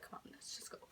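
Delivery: whispered urging to a friend; furtive whisper. Retold or imitated. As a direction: keep it barely audible, unvoiced breath only.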